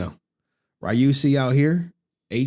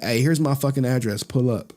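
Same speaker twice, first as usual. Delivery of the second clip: ordinary talk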